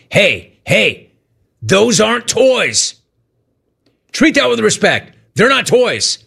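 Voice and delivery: a hint of a lecturing father; imitating stern dad. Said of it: "my dad would say to me".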